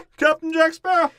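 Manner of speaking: very high, loud hammed-up cry; mock triumphant shout. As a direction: shout it high like a cartoon announcement. Acting out a triumphant film shout.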